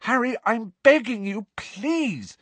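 high, pleading voice; frantic high-pitched plea; desperate. A narrator's reading. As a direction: beg him desperately. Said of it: "said Hermione desperately".

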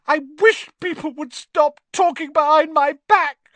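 high, tearful wail; on the edge of crying; choked with tears. Acted. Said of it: "in a voice choked with tears".

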